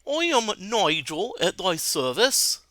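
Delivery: put-on slow posh British accent greeting. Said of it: "I'm gonna do Nigel with a British accent".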